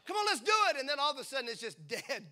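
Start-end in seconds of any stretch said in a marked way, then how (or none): 0.1-0.8 much higher, louder eager cheer; rally yourself like a cheerleader; hammed-up burst of zeal; pumped-up rally. Fired-up believer, retold.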